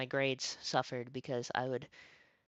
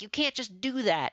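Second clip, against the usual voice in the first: higher, louder imitation of staff; mock scolding staff; 8 semitones higher, 6 dB louder than usual. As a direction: wag a finger at the kid. School staff scolding quoted.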